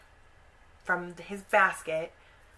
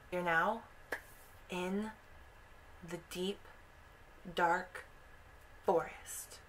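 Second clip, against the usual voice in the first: soft, halting storyteller hush; eerie scene-setting, word by word; hushed suspense; 8 dB quieter than usual. A narrator's reading.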